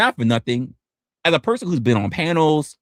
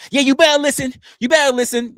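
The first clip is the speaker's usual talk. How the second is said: high, louder mocking threat; mock chatter; 11 semitones higher, 3 dB louder, faster than usual. Streamer mimicking a chatter threatening him. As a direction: play the puffed-up chatter.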